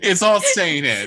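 laughing through the words; said through a real laugh. Real, not acted. Laughing while insisting.

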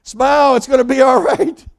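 high, loud, bright encouragement; Jesus calling to the disciples, high and loud; cheerful calling out. Retold or imitated. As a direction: lift their spirits over the storm.

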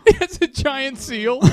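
slow, laughing and high. Real, not acted. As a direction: giggle through it. Laughing at joke.

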